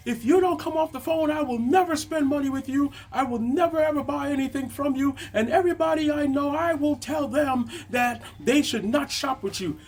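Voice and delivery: very high mock-angry customer voice; furious customer threat. Customer threatening never to shop there again, high mock fury. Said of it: "he continues again".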